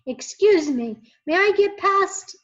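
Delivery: put-on boy yelling to get through. A narrator's reading. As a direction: push the call over the crowd. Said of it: "he yelled".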